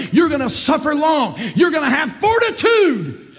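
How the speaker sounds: rousing preacher piling up phrases; full-force preaching. Real, not acted. Preacher urges burdened church to keep going, rousing. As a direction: press each phrase on them, rising to a cry.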